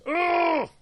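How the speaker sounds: high-pitched, hammed-up groans of frustration; exasperated anger. Host fuming over Sally's mother, exasperated anger. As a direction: vent in a high, mock-furious squeal. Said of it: "I'm so angry".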